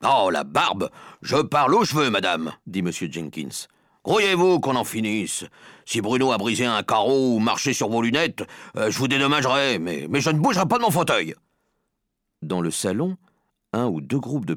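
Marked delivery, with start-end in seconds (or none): 0.0-2.5 exasperated, forceful comeback; fed-up retort. Acted. Rude father snapping back, fed up. 4.0-11.3 higher, raised irritated voice, quickening; impatient man digging his heels in; exasperated refusal. Acted. Impatient father refusing to budge.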